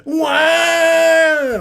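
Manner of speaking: a sudden high, loud cry; shouted yes. Retold or imitated. Weeping character bursts out with a loud yes. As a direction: blurt it out at full voice. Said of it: "et il fait".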